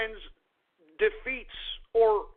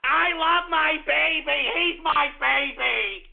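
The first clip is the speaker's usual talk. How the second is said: higher, slow cooing mother voice; mock coddling mommy; 5 semitones higher, slower than usual. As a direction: coo over your grown son like a doting mom. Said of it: "mommy ... it's like".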